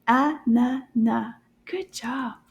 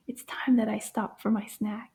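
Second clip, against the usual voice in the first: normal talking voice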